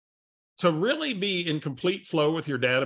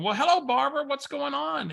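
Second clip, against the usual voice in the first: high, sing-song fake warmth; mock-enthusiastic hello; 12 semitones higher than usual. [name] answers a caller he can't place, bright and high. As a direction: play along like you know her. Warmly greeting the unknown caller.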